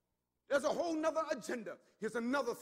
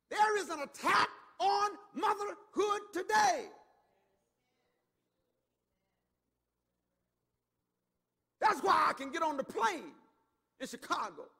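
full-force preaching; 4 semitones higher, 5 dB louder than usual. Acted. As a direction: thunder the point out to the church.

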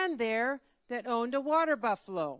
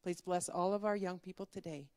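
soft, lower-pitched prayer, fading toward amen; closing prayer; 3 semitones lower, 4 dB quieter than usual. Real, not acted. Leader prays for young people at Faith Camp, reverently. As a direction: pray gently and simply, settling quieter. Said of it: "Let's have a quick prayer".

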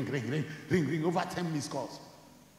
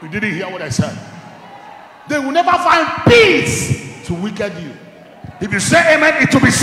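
much louder, higher preaching; fiery preaching; 6 semitones higher, 13 dB louder than usual. Acted. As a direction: shout the blessing over the church. Preaching at full force.

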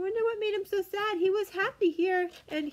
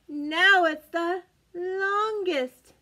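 very slow, louder reveal; dramatic longest; 4 dB louder, much slower than usual. Acted. Reading to children, very slow dramatic reveal. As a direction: stretch out the longest. Building comparison game with children.

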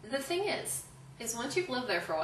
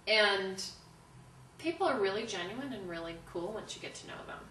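ordinary delivery

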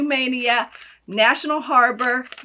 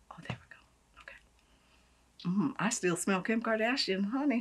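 ordinary talk, nothing marked

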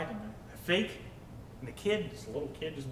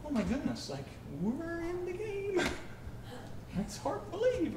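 high, breathless awe, much higher; giddy disbelief; 14 semitones higher, 5 dB quieter than usual. Retold or imitated. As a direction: squeal it like a thrilled teen who can't believe it. Retelling teen excitement.

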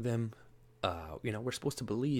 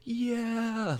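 high mock cheering; mocking TV host; 13 semitones higher, 4 dB louder than usual. Mocking the lady on The View throwing papers, high gleeful mock cheer. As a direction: squeal it like a giddy TV host. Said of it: "and she's like".

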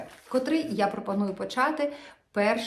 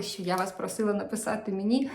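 usual speaking voice

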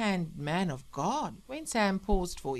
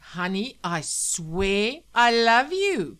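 loud, slow, higher-pitched sarcastic vow; bitter mimicry; 4 semitones higher, 10 dB louder, slower than usual. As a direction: throw his love declaration back at him, drawn out. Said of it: "she said bitterly".

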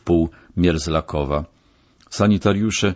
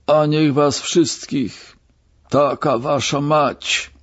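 drawn-out, high-pitched cursing cry; sudden yell; 8 semitones higher, slower than usual. A narrator's reading. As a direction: burst out cursing, stretching every word. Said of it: "wrzasnął niespodziewanie". (przeciągły, wysoki wrzask z przekleństwem)

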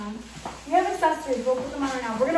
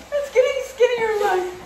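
excited; 7 semitones higher, 5 dB louder, slower than usual. Real, not acted. Costume deflating, panicked laughter.

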